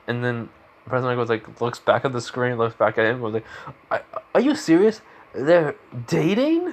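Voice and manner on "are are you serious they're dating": high, stammered disbelief; hammed-up shock at gossip; incredulous. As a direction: stretch the last word into a rising, amazed question. Acted disbelief at dating news.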